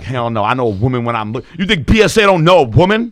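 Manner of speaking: loud, indignant insistence; mock outraged yell; indignant shout. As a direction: bark it out like an offended guy. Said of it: "he was like".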